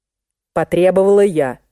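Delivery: ordinary delivery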